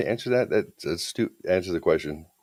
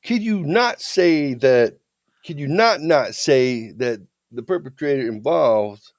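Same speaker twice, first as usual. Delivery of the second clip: high, loud, slow lawyer question; imitated defense attorney; 7 semitones higher, 4 dB louder, slower than usual. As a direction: drag out the double negative in mock cross-examination. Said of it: "he starts using those double negatives".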